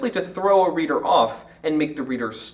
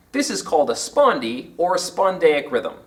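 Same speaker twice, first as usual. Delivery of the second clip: ordinary talk, nothing marked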